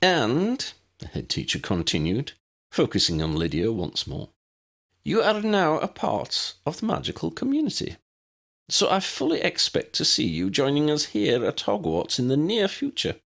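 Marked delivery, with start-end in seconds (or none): 0.0-0.7 high, prim Scottish headmistress voice; brisk, proper elderly professor; McGonagall. A narrator's reading. 5.0-13.2 high, prim Scottish headmistress voice; brisk, proper elderly professor; McGonagall. A narrator's reading. McGonagall pronouncement.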